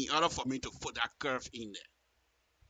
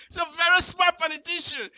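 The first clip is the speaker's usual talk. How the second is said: much higher and louder, mocking praise; gleeful praise; 13 semitones higher, 8 dB louder than usual. Real, not acted. Praising her political trick, high gleeful gush. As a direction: gush with ironic admiration.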